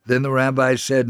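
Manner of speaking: ordinary delivery, nothing marked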